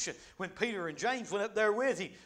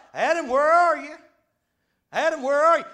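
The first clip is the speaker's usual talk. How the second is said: high, loud summons; big, far-carrying preacher's shout; calling out; 8 semitones higher, 13 dB louder than usual. Acted. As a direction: call out as if searching for him. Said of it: "he began to call out".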